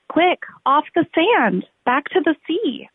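high, loud, urgent little voice; snail's urgent cry. Acted. The snail urges the beached whale back to sea, high urgent cry. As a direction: shout it up high like a tiny frantic snail. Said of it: "cried the snail".

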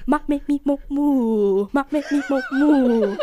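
hammed-up mouth warm-up babble; vocal warm-up. Shown as a demonstration. Actor demos his pre-show warm-up, silly babble. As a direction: loosen the lips with silly syllables. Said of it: "try to get my mouth warmed up".

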